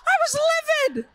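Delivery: loud and high, stretching "livid". Real, not acted. Wife telling the crowd how furious she was, loud. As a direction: let the anger burst out on "livid".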